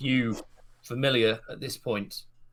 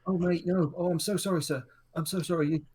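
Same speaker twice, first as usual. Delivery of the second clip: higher put-on voice, apologizing profusely; groveling guard; 8 semitones higher, faster than usual. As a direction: grovel before the inspector.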